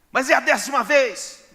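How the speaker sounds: high, loud mock wife, fed up; exasperated wife. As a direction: play the wife who has heard it ten times. Wife scolding husband's apology. (esposa irritada, voz aguda e alta)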